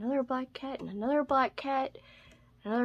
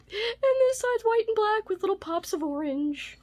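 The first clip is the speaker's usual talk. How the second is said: cutesy high voice; 11 semitones higher than usual. Real, not acted. Showing off a cute bookmark.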